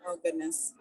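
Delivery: plain reading voice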